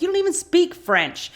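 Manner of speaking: high, loud imitated yell; mock angry yell. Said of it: "Sawyer yells".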